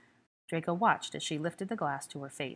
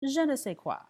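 puckered, higher, louder British-French je ne sais quoi; hammed-up French from a Brit; 9 semitones higher, 5 dB louder than usual. A narrator's reading. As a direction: pout and lay on a mock French accent. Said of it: "pucker of a Brit about to speak French".

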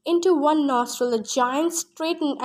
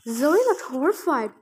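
usual speaking voice

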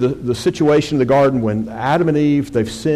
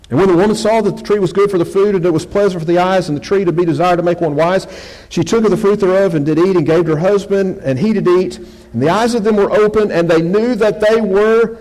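louder, higher sermon reading voice; Scripture reading; 6 semitones higher, 4 dB louder than usual. Acted. Preacher reads Genesis to his congregation, loud Scripture reading. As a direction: proclaim the verse to the congregation.

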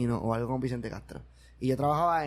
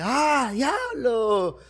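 drawn-out high "diablo" in a different voice; mock awe, very slow and high; amazed owner; 9 semitones higher, much slower than usual. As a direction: stretch it out in awe. Owner amazed at seeing the actor.

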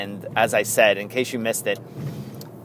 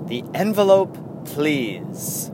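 awards presenter; 4 semitones higher, slower than usual. Retold or imitated. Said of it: "at an awards show and they're like".

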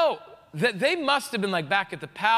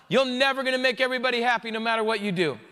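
ordinary talk, nothing marked

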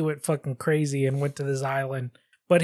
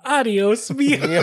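higher, laughing doll voice; creepy doll voice; 8 semitones higher, 3 dB louder than usual. Retold or imitated. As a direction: copy the doll voice, breaking into laughter. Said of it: "That was weird".

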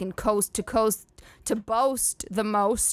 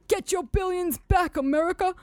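put-on old man ad voice; 8 semitones higher than usual. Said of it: "hindsight is always".

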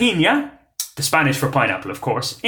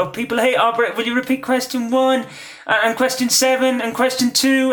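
high, whiny-pupil style pleading; mock pestering contestant; 8 semitones higher than usual. Quizmaster imitating players asking for repeats, high-pitched pestering. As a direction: mimic a needy player, pitched well up.

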